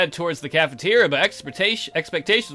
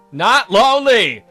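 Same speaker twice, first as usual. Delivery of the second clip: louder, higher, drawn-out denial; emphatic protest; 4 semitones higher, 8 dB louder, slower than usual. Acted. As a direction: insist loudly, stretching it out. Repeats denial, now protesting.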